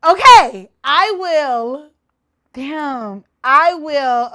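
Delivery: normal talking voice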